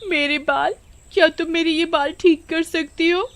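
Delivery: high, pleading mock sob; fake crying. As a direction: wail pitifully to win sympathy, faking it. Vimla fake-crying to the witch. (झूठ-मूठ का रोते हुए)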